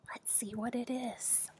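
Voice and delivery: soft whisper; hushed suspense. Real, not acted. Reader to children before turning the page, whispered. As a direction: whisper it before the big reveal.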